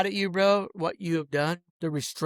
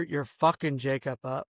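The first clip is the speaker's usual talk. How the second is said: ordinary talk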